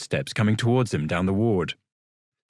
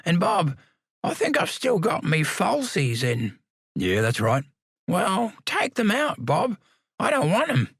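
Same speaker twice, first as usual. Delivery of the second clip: put-on high stubborn old man; cranky old man; 8 semitones higher than usual. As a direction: grumble like an obstinate old lag. Samuel demands teeth out.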